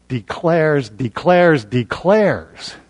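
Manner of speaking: slow, separate beats on each "declares"; emphatic repeated declares. Shown as a demonstration. Preacher driving home the passage's point, slow insistent stress on "declares". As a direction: pound "declares" out slowly, the last one falling away. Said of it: "he's putting special emphasis on it".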